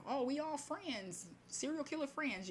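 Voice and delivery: mocking friends voice; mock chummy group. Mimics group of serial killer friends.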